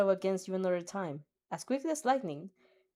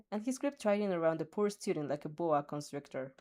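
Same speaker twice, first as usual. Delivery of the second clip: ordinary delivery, nothing marked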